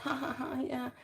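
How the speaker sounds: soft, high mock laugh; imitated laugh. Commentator imitating the streamer's reaction to a comment, soft mock laugh. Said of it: "you know, and she laughed".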